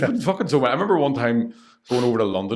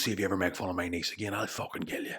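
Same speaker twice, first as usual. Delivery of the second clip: hushed menacing threat, softer; threatening whisper; 9 dB quieter than usual. Retold or imitated. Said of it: "And he goes".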